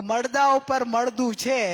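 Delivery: slow and pointed, મળદા ઉપર spaced out; slow pointed line. Shown as a demonstration. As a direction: lay it out slowly to puncture pride. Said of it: "હું કહું કે".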